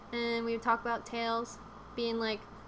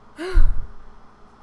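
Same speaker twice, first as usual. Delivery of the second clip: big heavy sigh; loud exaggerated sigh; 6 semitones higher, 16 dB louder than usual. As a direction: let out a long dramatic sigh.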